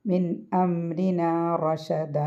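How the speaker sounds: slow മിൻ അംരിന റഷദ, noon clear and റ heavy; drawn-out model reading of മിൻ അംരിന റഷദ; careful tajweed recitation. Shown as a demonstration. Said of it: "അത് ഉച്ചരിക്കേണ്ട നൂനാണ്".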